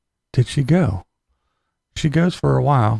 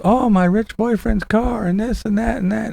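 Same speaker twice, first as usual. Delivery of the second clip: high bragging mock voice; mocking Morgan; 8 semitones higher than usual. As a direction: brag airily about the car. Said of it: "All this".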